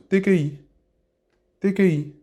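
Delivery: T K I said high with its tones; tonal demo. Shown as a demonstration. Teacher repeats petit for learners, high tonal T K I. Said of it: "Avec les intonations".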